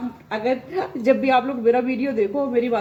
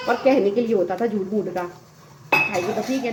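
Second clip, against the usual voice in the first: usual speaking voice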